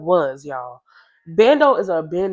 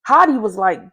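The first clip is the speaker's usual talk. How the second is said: ordinary delivery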